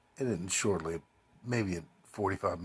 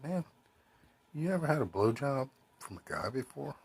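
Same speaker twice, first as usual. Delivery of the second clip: pitched high, half-hearted imitation of the stranger; a hint of a sleazy stranger, mock-casual; mock stranger's voice; 7 semitones higher than usual. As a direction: lightly put on, asking it casually. Stranger's creepy proposition, retold.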